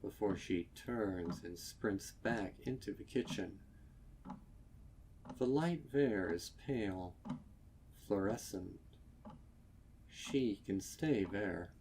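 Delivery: ordinary delivery, nothing marked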